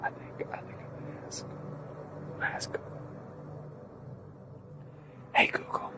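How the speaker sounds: soft whisper. Real, not acted. Lying on the ground asking Google, whispering. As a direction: keep it a hushed whisper.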